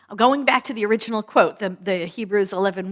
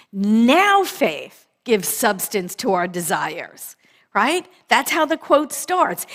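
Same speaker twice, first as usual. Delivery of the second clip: usual speaking voice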